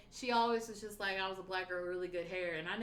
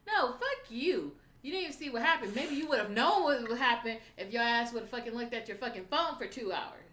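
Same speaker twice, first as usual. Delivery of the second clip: exasperated comeback, pitched up and punched; indignant telling-off; 3 semitones higher, 5 dB louder than usual. Real, not acted. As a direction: tell them off loudly and indignantly. Retold argument with boyfriend.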